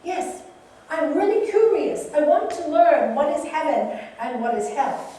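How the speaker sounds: loud, high, eager samurai demanding to learn. Acted. Samurai to his friend, loud and eager. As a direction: blurt it out like a proud warrior. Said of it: "And he goes".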